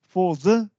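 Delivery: ordinary talk, nothing marked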